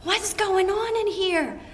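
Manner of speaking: high alarmed mock husband voice; husband bursting in. Husband running in from the yard, high alarmed call. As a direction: call out like a worried husband rushing in. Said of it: "he says".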